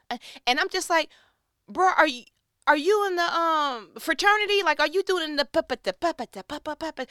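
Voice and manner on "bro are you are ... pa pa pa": high, loud, disbelieving badgering; incredulous mock outburst. Wife to husband juggling little problems, incredulous. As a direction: pitch it way up, baffled and teasing.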